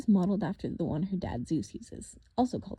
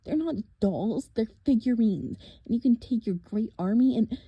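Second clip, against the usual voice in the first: put-on indignant boy; 3 semitones higher, 7 dB louder than usual. Nico indignant at villain.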